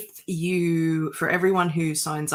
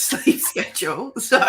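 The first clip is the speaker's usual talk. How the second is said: usual speaking voice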